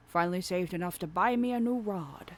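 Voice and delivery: put-on hearty old salt; lightly voiced fisherman. Voicing Willy.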